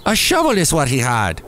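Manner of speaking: put-on Irish pub storyteller; joke-telling Irish voice; attempted Irish accent. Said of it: "I'm gonna try and do an Irish one".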